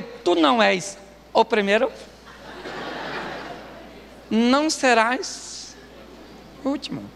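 exaggeratedly composed put-down; mock politeness. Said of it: "Aí eu respiro fundo, que sou um cara muito educado".

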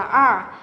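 R with the tongue curled up; retroflex R. Shown as a demonstration. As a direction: curl the tongue and say R. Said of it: "lưỡi chúng ta cuốn lên, đọc là R". (R uốn lưỡi)

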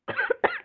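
sudden cough from fruit juice; choking cough. Real, not acted. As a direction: cough as if juice went down wrong. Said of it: "choking on fruit juice".

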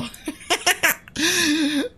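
short high amused laugh. Real, not acted. Laughs at the chat joke. (petit rire aigu)

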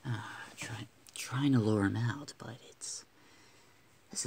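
soft whisper to avoid spooking him. Real, not acted. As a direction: keep it a hushed whisper. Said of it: "sorry for whispering".

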